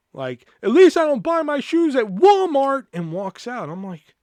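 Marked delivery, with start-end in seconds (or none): 0.6-2.9 loud, high-pitched parting jab; mock yell. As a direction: imitate her yelling back from the door. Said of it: "she, like, yells at me".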